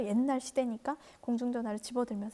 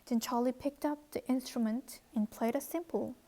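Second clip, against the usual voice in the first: very slow, deliberate English read-aloud; much slower than usual. Shown as a demonstration. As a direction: read each word slowly for learners. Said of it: "reads the English text slowly".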